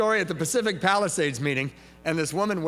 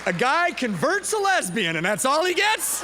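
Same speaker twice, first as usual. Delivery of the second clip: high, loud, slowed mock grievance; mock outrage; 11 semitones higher, 6 dB louder than usual. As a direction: complain to the room in playful outrage. Mock complaint to the audience.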